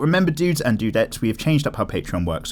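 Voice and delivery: ordinary delivery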